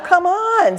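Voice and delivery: ordinary talk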